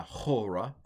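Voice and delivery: ordinary talk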